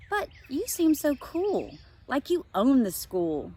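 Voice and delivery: high, slow, wide-eyed marveling; amazed girl. Acted. Josie slowly marvels at how cool Vanessa seems. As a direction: sound truly amazed by her.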